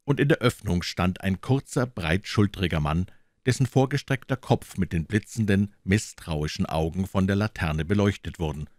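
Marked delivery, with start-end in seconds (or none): none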